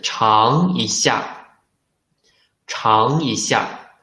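尝一下 drawn out, 一 said in second tone; slow teacher model of 尝一下; slow tone drill. Shown as a demonstration. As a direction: model 尝一下 slowly for students to repeat. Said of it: "should be changed into the second tone".

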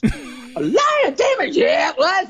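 very high, stretched-out disbelief; shocked squeal. Acted. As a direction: squeal it as if it can't be true. Friend shocked by lion job.

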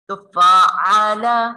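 slow फाअला with a strong ain; clear ain. Shown as a demonstration. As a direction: stress the throaty ain. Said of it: "आइन की आवाज़ निकालिए".

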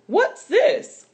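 shocked to find something strange; surprised cry. Acted. Mama finding strange food in her basket, surprised cry. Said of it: "cried Mama".

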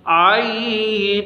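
عین held very long from the throat; slow throaty عین; throaty ain. Shown as a demonstration. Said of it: "یہ بھی حلق سے نکلے گی آواز".

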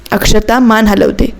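plain reading voice

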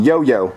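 "Yo-yo" with two long O sounds; "Yo-yo" as an example to copy; correct pronunciation. Shown as a demonstration. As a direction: model "Yo-yo" low and simple. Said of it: "Read the bottom".

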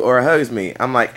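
ordinary talk, nothing marked

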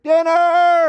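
very high, loud holler; long, ringing call across the neighborhood; mom calling out. Retold or imitated. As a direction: imitate a mom yelling for faraway kids. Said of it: "And then I would hear".

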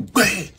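high, punchy imitated thump; imitated table bang. Angry woman hitting the table, imitated as a punchy bang. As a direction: bang out the sound of a table being hit. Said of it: "Tonto just hit table".

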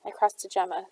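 usual speaking voice

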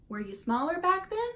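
put-on wide-eyed young goose asking; curious gosling. A narrator's reading. Gosling asks.

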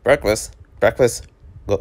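ordinary talk, nothing marked

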